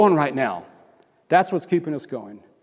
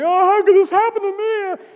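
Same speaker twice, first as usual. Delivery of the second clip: high, loud mock lament; hammed-up self-pitying cry; mock wailing; 6 dB louder than usual. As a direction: wail it like a panicked whiner. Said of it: "crying and screaming".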